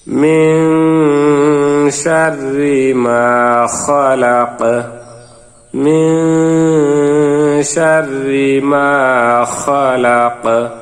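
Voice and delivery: slow sung chant, final qaf bounced at the stop; recitation with qalqala stop. Shown as a demonstration. Teacher shows the pause on খলাক with a bounce. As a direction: chant and echo the last letter as you pause. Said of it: "যখন আমরা অক্ষ করব ... কলকলা উচ্চারিত হবে".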